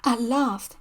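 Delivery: ordinary delivery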